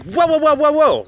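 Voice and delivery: high, loud string of whoas; mock alarm. Stopping viewers jumping on the outrage express, mock alarmed. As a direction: halt them with exaggerated alarm. Halting the imagined angry viewers.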